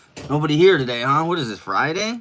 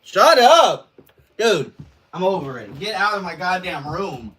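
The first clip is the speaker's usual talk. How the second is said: angry yelling; 9 semitones higher, 10 dB louder than usual. Real, not acted. Yelling at someone in room.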